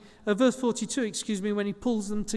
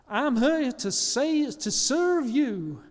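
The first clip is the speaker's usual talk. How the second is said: slow, drawn-out mock American politician, pitched up; American accent; 4 semitones higher, 3 dB louder, much slower than usual. Politician to voters after winning an election, hammed-up American accent. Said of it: "I don't know why that was an American accent".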